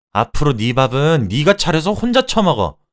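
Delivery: loud, high-pitched parting outburst; angry shout. Acted. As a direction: yell it at your husband before storming off. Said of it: "라고 소리치고". (화내며 소리치는 말투)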